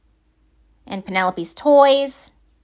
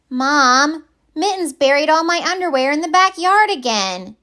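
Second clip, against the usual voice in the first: put-on high, bright little-girl complaint; child voice; 6 semitones higher, 3 dB louder than usual. Penelope tattling to Mom about underwear, high child voice. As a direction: whine it up to Mom like a kid.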